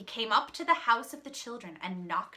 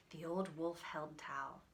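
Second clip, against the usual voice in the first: ordinary delivery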